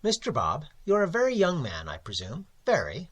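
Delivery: pompous editor, loud and condescending; Mister Crabbe. Acted. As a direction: size up the young poet with mock warmth. Editor Crabbe speaks to narrator.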